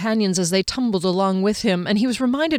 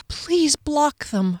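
slow, much higher plea; imploring; 8 semitones higher, much slower than usual. A narrator's reading. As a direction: beg the trees, drawn out. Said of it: "Fennel implored".

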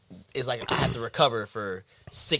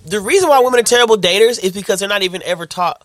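loud, high insistence; emphatic; 6 semitones higher, 9 dB louder than usual. Real, not acted. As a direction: drive the point home. Emphatic argument.

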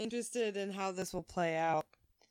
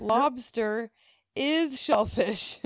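"Lobster is shellfish" loud, slow, word by word; pointed explanation; 2 semitones higher, 8 dB louder, much slower than usual. Retold or imitated. Hostess spelling out the obvious.

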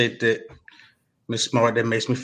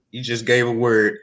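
ordinary talk

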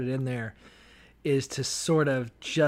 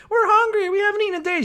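put-on high, loud whining child voice; whiny kids; 7 dB louder, faster than usual. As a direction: whine like hungry kids in the backseat. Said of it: "He's like".

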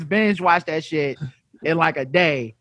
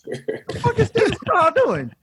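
much higher, laughing disbelief; baffled laughing; 13 semitones higher than usual. Retold or imitated. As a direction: laugh it out, totally bewildered. Bystanders baffled by Seagal.

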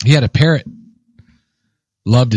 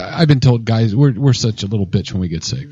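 normal talking voice

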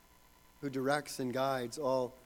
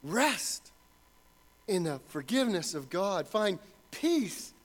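louder, ringing assurance; preaching at full force; 6 semitones higher, 5 dB louder than usual. Real, not acted. Pastor assuring believers of God's pardon, full-voiced preaching. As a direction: preach the assurance out to the back row.

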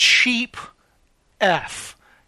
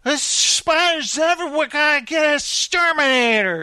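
high-pitched imitation of a panicking wife; mock freaking out; 6 semitones higher than usual. Wife to husband about spiders, mock freaking out. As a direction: act out a frantic wife, pitched up. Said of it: "they're freaking out in the house".

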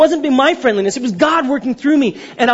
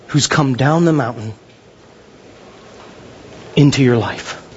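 slow, lower preaching voice with long pauses; solemn altar call; 6 semitones lower, slower than usual. Real, not acted. Preacher addressing congregation with bowed heads, solemn altar call. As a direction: lead them gently and deliberately toward prayer.

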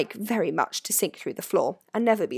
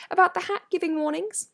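much higher questioning voice; Hermione; 11 semitones higher than usual. A narrator's reading. Hermione asks about the hat's warnings, high-voiced. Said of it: "Hermione asked the ghost".

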